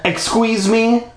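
high, drawn-out outraged question; indignant cry. Acted. Stephanie to her trickster captor, indignant cry. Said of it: "more angry than frightened".

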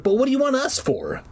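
high, quick protesting question; anxious demand. A narrator's reading. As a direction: blurt it out pitched high. Frightened captive questions the dogs.

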